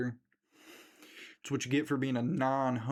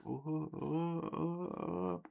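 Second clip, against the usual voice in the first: mock panicked whimper; 4 semitones higher, 6 dB quieter, much slower than usual. Bear panicking with gun.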